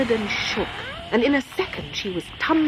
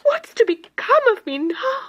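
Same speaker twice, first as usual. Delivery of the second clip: sobbed; 4 dB louder than usual. Acted. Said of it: "she sobbed".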